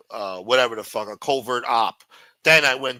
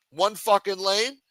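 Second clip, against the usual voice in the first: ordinary talk, nothing marked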